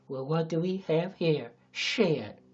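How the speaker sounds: slow, drawn-out Texas drawl; hammed-up Texas accent with menace; angry Texan. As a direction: sneer it in a put-on Texan accent. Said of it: "I don't know why he's so angry".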